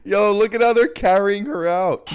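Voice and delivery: loud, amused and high; excited high voice. Real, not acted. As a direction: call it out while laughing. Streamer laughing, voice jumps up.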